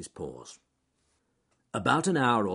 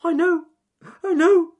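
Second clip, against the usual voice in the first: very high, loud little rabbit voice; Ernest Fluffy Rabbit; 14 dB louder than usual. A narrator's reading. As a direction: squeal it from the burrow as a frightened rabbit. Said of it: "said Ernest Fluffy Rabbit from inside his burrow".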